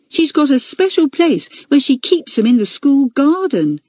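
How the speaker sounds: put-on high, innocent child voice; little schoolgirl. A narrator's reading. Junior explaining slug hiding place, innocent little schoolgirl. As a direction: explain it eagerly as a little girl.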